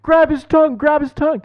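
high, loud imitated panic; high frantic imitation of the dad; panicked dad. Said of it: "My dad's like".

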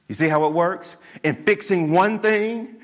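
normal talking voice

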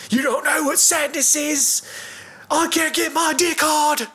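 high, slow hammed-up lament; mock melodrama. Friend retelling the movie's sad confession, mock lament. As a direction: wail it like a tragic actor. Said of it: "to him telling him".

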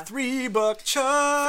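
drawn-out, high, loud announcer cry; excited shout. Acted. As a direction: belt it out like a game-show host. Announcing the sponsor's wine.

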